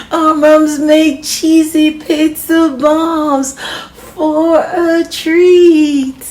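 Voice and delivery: slow, high, loud giggly sing-song; laughing announcement. Acted. Little brother announcing the treat to everyone, laughing and loud. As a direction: drag out the words through laughter.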